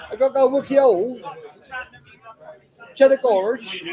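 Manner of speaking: put-on hamming up a Somerset yokel accent; mock West Country accent. Teasing Paul about Somerset in a mock West Country accent. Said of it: "You bloody talk like that".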